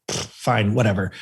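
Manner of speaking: low imitated giving-in; resigned mom. Mom giving in to the store trip, resigned. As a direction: concede it dismissively, pitched low.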